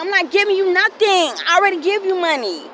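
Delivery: high, loud furious retort; shouting at the aunt over money; angry yelling. Retold or imitated. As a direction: yell it back at her, fed up. Retold fight with aunt.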